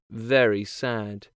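usual speaking voice

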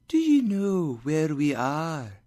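high, airy otherworldly question; ghostly spirit. Acted. Spirit asks Scrooge outside the warehouse, ghostly. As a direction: ask it in a floating spirit voice.

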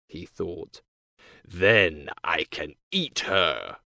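low, scheming wolf. A narrator's reading. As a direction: play the hungry wolf, low. Wolf's voice.

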